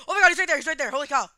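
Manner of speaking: loud panicked gasping words; scared and shouting; panicking gamer. Real, not acted. Jumpscare in horror game.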